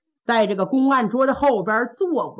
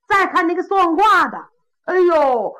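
plain reading voice

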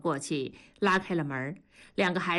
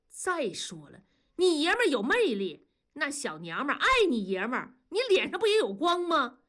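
high-pitched, smug self-defence; cocky boasting; 7 semitones higher than usual. A narrator's reading. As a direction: brag your way out of trouble. Husband cockily boasting mid-quarrel. (得意地狡辩)

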